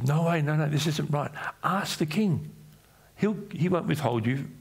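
mild alarmed protest; pleading refusal. Acted. Preacher voicing Tamar refusing her brother, high mild plea.

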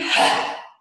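big noisy sigh out; loud volcano breath out; loud tantrum exhale. Shown as a demonstration. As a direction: blow it out like a volcano. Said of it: "This is our tantrum breath".

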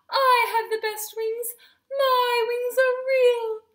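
loud, high, crying protest; acted wailing. A narrator's reading. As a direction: wail it like a sore loser, drawn out. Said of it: "Goosey wailed".

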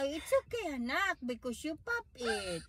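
very soft, hushed reassurance; barely above a murmur, soothing; gentle comfort. Real, not acted. Mother hushing her crying son, very soft and tender.

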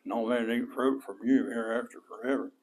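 low, soft mumble under the breath; mumbled. Shown as a demonstration. How not to curse the tree, mumbled low and soft. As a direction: mumble it so nobody hears. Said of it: "go up there and mumble something".

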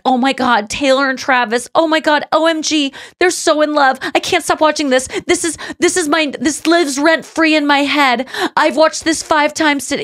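high, breathless-fan parody; gushing fan posts. Retold or imitated. Critic parodying Swifties posting about [name] and [name], mock gushing. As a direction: run through mock swooning exclamations. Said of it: "Why do they keep posting".

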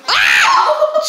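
high, loud laughing ow; sympathetic ow. Real, not acted. Reacting as the little girl falls down, laughing yelp. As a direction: wince with a laugh as she falls.

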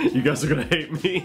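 high, giggly, talking through laughter; helpless amused giggle; laughing. Real, not acted. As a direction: crack up at your own joke. Laughing at own impressions.

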